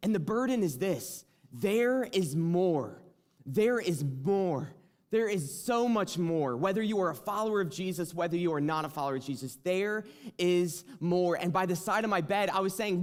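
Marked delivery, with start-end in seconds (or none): 0.0-11.4 preaching. Acted. Preaching the message.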